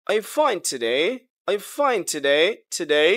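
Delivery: yes-no question lifting on "today"; teacher modelling a rise on "today"; rising tone question. Shown as a demonstration. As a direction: let "today" climb at the end. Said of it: "rising tone হবে".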